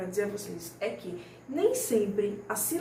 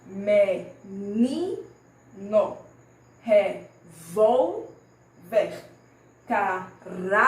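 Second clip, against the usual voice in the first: "Menino, revolver, caráter" with NI, VOL and RA punched louder; slow syllable-by-syllable teaching of Menino, revolver, caráter, strong syllable drawn out; stressed syllables; 2 semitones lower, 4 dB louder, slower than usual. Shown as a demonstration. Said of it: "pronunciar com mais intensidade".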